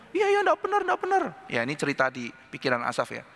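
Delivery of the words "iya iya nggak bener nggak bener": high squeaky mock agreement; mock agreeing voice. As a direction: pipe it up as a mocking inner voice. Voicing Asaf's thoughts. (suara tinggi mengejek)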